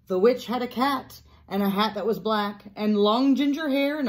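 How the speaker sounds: lively, sing-song story reading; picture-book narration. A narrator's reading. Teacher reads Room on the Broom aloud, animated storytelling. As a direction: read it out with storybook drama, building to the mishap.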